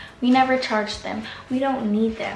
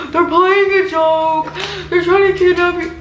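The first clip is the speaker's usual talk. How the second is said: mocking dad; 6 semitones higher, 4 dB louder than usual. Mocking dad's dismissal.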